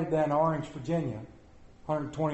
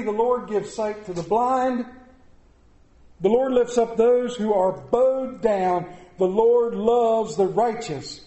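louder, building proclamation, slowing on the Lord lines; preaching the psalm; 5 semitones higher, 4 dB louder than usual. Real, not acted. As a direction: proclaim each line like a rising drumbeat. Proclaiming psalm at full force.

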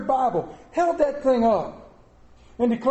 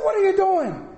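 exasperated dad; 11 semitones higher, 4 dB louder than usual. Retold or imitated. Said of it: "I said".

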